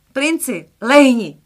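high, loud shout at the dog; a boy shouting at his dog to lie down; shouted command. Acted. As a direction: order the dog down sharply. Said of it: "křikl Slávek".

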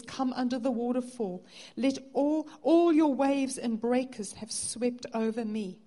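a preacher's fervent call; impassioned preaching. Acted. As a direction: call them under the waterfall with fervour. Voices Jesus's invitation, preaching.

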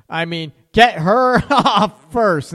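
loud, slow, laughing fix of the slip; laughing correction. Real, not acted. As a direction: correct yourself through laughter. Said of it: "not get rid of her first".

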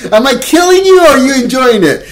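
loud, high incredulous question; exasperated shout. Retold or imitated. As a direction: yell it over the moaning.

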